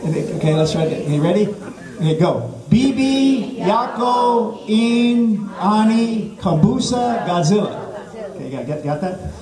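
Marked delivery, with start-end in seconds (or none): 2.7-7.8 high, drawn-out foreign phrase; mock language-lesson recitation. Shown as a demonstration. Comic teaching the crowd Swahili, slow high recitation. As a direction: intone each word slowly and high for repeating.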